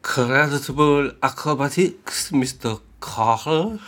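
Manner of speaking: a hint of a French accent, slow on Carr; light French accent with a laugh. Host reading the line in a light French accent.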